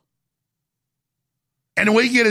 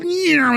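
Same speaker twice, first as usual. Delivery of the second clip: a much higher, sharp "Yeah" like tiny craft shooting away; playful mock whoosh, pitched well up; orbs whooshing off; 9 semitones higher than usual. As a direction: toss off a high zippy "Yeah" for the little things flying off. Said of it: "all these little things go".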